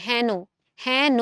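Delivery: হেন as "hæno", with an open front vowel. Shown as a demonstration. Teacher repeating for students, হেন with open "æ". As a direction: widen the first vowel of হেন to "æ". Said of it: "তাহলে এর মতো উচ্চারণ হচ্ছে".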